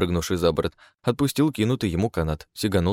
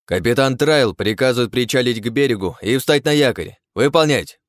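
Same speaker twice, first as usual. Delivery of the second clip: raised, higher commanding voice; barked orders; 4 semitones higher, 5 dB louder than usual. Acted. Pirate relaying the new captain's orders to crew, commanding. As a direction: call the orders out across the deck.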